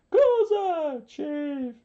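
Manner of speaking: very high, loud shout; crying out. A narrator's reading. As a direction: shriek to the leader in alarm. Rebels cry out to leader.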